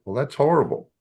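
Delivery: high, sympathetic "that's horrible"; "that's horrible" said high with dismay; sympathy. Shown as a demonstration. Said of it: "Show sympathy".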